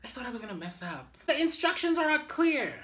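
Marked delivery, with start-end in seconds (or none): 1.3-2.7 loud, high protest; high, frustrated outburst; exasperated complaint. Real, not acted. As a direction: complain loudly at the instructions. Frustrated with the instructions.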